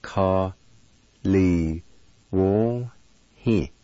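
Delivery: "Ka, li, wo, hi" with a pause after each syllable; syllable-by-syllable demo. Shown as a demonstration. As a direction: sound out "Ka, li, wo, hi" slowly for learners. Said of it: "is pronounced like this".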